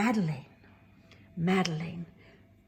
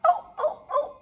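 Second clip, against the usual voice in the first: squeaky surprise; 6 dB louder than usual. Acted. Penny startled reaching top.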